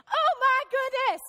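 high, loud frantic cry, slowing down; panicked outburst. Retold or imitated. Wife jolting awake in panic, loud frantic cry. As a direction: blurt it out in a half-asleep panic. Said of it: "And he goes".

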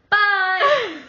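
put-on a little girl shouting bye down the phone; excited child's bye. Little sister shouts bye back.

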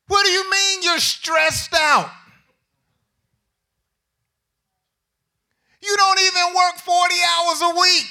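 incredulous scolding. Acted.

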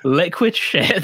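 forcing the words out through laughter; laughing shout. Real, not acted. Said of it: "You didn't do a very good job of composing yourself".

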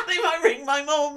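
high, loud mock-panicked kid voice; mock his friend's schoolboy panic; mocking worried kid. Teasing his friend about the cinema, a high mocking kid voice.

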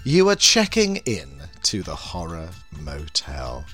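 sultry horror hostess welcome; femme fatale. Acted. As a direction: purr it like a vampy hostess. Horror hostess intro persona.

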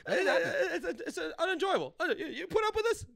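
a hint of a high indignant whine; mock indignant. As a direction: sound appalled in a high voice. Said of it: "imagined rant at her date".

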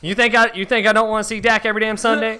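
put-on high, fast country-accent rant; hammed-up country accent. Homeowner who wants the Cowboys, indignant country accent. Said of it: "went through so many different accents".

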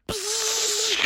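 long hissing zap noise; proton pack blast. Acted. Mommy fires her proton pack, imitated blast. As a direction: make a mouth-made blaster hiss.

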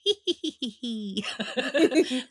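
high, loud witch cackle. Shown as a demonstration. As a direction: cackle like an old hag. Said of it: "that's how I do my witch laugh".

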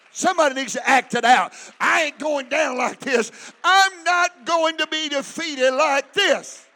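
full-force preacher's shout; fervent preaching. Real, not acted. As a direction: hammer each declaration out to the congregation.

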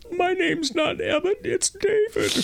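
put-on high, tearful little-kid wail; slow, very high-pitched pretend crying; mock crying. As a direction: imitate a small child protesting through tears. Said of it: "And I start to cry and say".

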